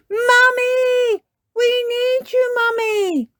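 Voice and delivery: high, loud shout for Mummy. A narrator's reading. Peppa shouts down from bed for Mummy, high and loud. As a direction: call loud from upstairs. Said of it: "they heard Peppa shout".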